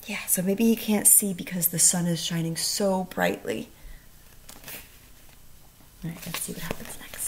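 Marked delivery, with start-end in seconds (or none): 6.0-7.3 1 whisper. Real, not acted.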